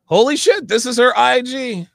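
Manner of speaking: loud, high excited burst; excited exclaim. Real, not acted. Friend finding Megan's profile, loud excited exclaim. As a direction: blurt it out thrilled.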